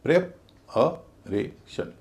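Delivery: प्रेपरेशन very slowly, one syllable at a time; drawn-out teaching demo of प्रेपरेशन in separate syllables. Shown as a demonstration. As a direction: leave a gap after each syllable of प्रेपरेशन. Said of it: "उसको भारी बोला जाएगा".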